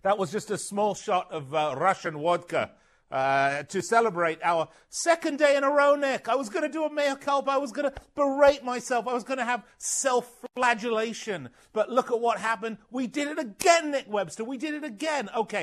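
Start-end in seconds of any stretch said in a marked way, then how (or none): 3.6-15.3 high, gleeful bragging; host boasting to his co-host about their picks; gloating celebration. Real, not acted. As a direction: crow over the win. Gloating over a winning prediction.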